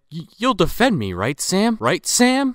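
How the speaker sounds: high, begging voice; a pleading client begs his lawyer; pleading Santa. Retold or imitated. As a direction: plead with Sam, rising each time. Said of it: "and he's like".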